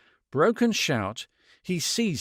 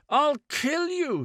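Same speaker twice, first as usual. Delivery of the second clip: near scream; 13 semitones higher than usual. A narrator's reading. Man threatening the woman, pitched almost at a scream. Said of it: "His voice rose until it was pitched almost at a scream".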